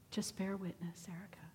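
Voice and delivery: soft, calming aside; gentle hushed instruction. Retold or imitated. The Lord telling her to just listen, gentle hushed instruction. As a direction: speak quietly to settle someone panicking.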